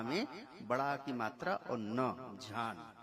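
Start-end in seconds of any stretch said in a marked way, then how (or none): 2.4-2.9 "झान" said softly with a long aa, as in Pali; "झान" pronounced jhaan, breathy jh and drawn-out aa; Pali word. Shown as a demonstration. Lecturer shows the Pali form of the word: "झान" said softly, aa drawn out. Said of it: "पाली भाषा में एक शब्द है झान".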